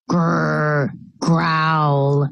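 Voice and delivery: low growling like an angry dog. Acted. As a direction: growl at them like a guard dog. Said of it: "Sparky, stop that".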